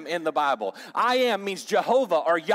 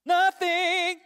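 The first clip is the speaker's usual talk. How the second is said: "Nothing." jumped way up high and louder, joking; mock squeal; 13 semitones higher, 4 dB louder than usual. Pastor teasing his church, a high mock "Nothing.". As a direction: squeak out "Nothing." as a playful bit. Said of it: "No, I'm just kidding".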